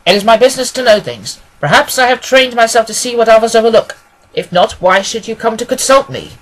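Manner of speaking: self-assured detective. Acted. Detective telling a new client it is his business to know things, self-assured. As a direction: explain your powers with brisk assurance.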